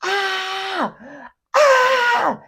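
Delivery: high, strained crying wail, re-enacted; a high crying ah, ah, as the boy did; imitated child's scream. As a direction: reproduce the kid's anguished ah-ah scream. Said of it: "I hear him yelling out".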